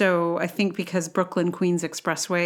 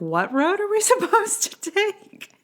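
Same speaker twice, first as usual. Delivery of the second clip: very high, laughing confused driver; mock bafflement; 13 semitones higher than usual. Podcaster imitating confused driver following the GPS, mock bafflement. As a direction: squeal the question in exaggerated confusion.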